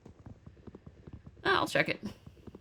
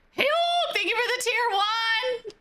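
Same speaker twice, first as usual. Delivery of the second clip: squeaky high-pitched thank you; 11 semitones higher than usual. Pretend.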